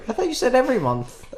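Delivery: put-on high whining kid; whiny kid. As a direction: pitch up into a whiny child. Voicing kid in negotiation.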